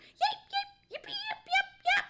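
high, loud puppy yips; rapid high-pitched barking; yipping dog. A narrator's reading. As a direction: yip like an overexcited little dog. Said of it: "He barked".

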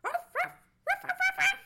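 high, loud little-dog barks; sharp high ruffs in bursts; excited dog barking. A narrator's reading. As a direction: bark like a frantic small dog. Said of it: "and barked".

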